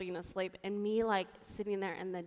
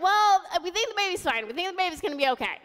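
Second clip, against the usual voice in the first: raised, higher-pitched mock yell; retold yelling; 8 semitones higher, 6 dB louder, faster than usual. Staff yelling news of the baby to the mother, mock yell. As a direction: imitate medical staff yelling over each other. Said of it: "and they're yelling".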